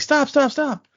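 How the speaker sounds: high, urgent retold cry; urgent halting call. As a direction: mimic someone calling a sudden halt, pitched high. Shallan urgently halts the bridge.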